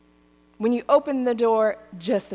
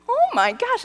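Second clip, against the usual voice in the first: exasperated; 13 semitones higher, 4 dB louder than usual. Retold or imitated. Retold exasperation.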